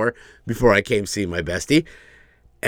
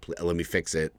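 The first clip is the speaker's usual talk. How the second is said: soft, sorry voice; apologetic; 5 semitones lower, 6 dB quieter than usual. Retold or imitated. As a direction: apologize gently and quietly. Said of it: "I'm gonna say".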